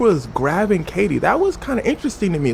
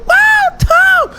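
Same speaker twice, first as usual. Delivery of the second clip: high, loud crowd shout; wild crowd cheer; 9 dB louder than usual. Retold or imitated. Crowd greets Tom Cruise on Oprah's show, wild shouting. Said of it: "The crowd was going wild".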